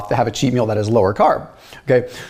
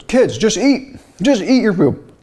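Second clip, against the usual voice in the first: mildly exasperated dad, pitched higher; higher-pitched mock fatherly urging; retold exasperated dad; 6 semitones higher, slower than usual. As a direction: imitate a dad fussing at his kids. Retold upset dad ordering kids to eat.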